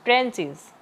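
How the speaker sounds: Trenches stressed on ट्रें; trenches, hit the first syllable ट्रें; stress on TRE. Shown as a demonstration. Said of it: "इसमें भी starting में ही आया". (Trenches में ट्रें पर ज़ोर)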